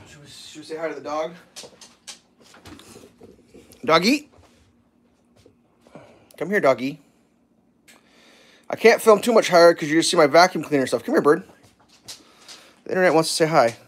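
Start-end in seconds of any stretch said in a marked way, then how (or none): 3.8-7.0 calling the dog. Real, not acted. 11.0-11.4 calling the dog. Real, not acted.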